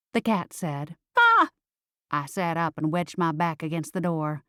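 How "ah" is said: short, high, loud shriek; startled scream. A narrator's reading. Driver jumps at the cat's boo, startled shriek. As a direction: yelp out in fright.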